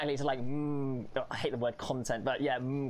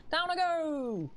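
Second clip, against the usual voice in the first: drawn-out gleeful cry; 13 semitones higher, slower than usual. Real, not acted.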